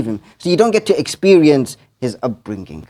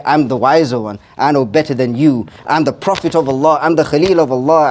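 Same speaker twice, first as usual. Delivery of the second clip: ordinary talk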